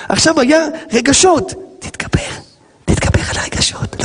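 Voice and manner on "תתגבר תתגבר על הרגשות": soft whispered self-talk; calm yourself down under your breath. Acted. Rabbi whispers to himself to overcome his feelings.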